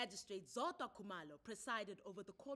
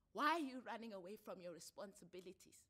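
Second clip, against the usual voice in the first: mild mock shout; 7 dB louder than usual. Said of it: "shouted Thuli impatiently".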